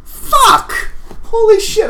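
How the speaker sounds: sudden high burst of shock. Acted. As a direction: blurt it out startled. Sudden memory exclamation.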